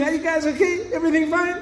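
high, friendly and light; greeting the smoker with a big smile; smiling check-in. Retold or imitated. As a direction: grin at them as you ask.

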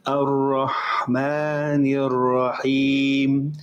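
Ar-Rahmanir Rahim stretched slowly with a heavy ar; slow correct recitation. Shown as a demonstration. Teacher modelling Ar-Rahmanir Rahim for students, slow and clear. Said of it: "Ar-Rahman is not correct".

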